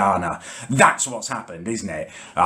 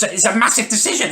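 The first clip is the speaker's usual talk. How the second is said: higher, laughing through the words it's a massive decision; laughing mockery; 12 semitones higher than usual. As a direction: scoff at it with a laugh. Mocking sister's big claim.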